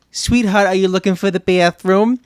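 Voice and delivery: sweet New York mom voice; a New York mom calling sweetheart; New York accent. Retold or imitated. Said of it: "that is the accent I grew up with".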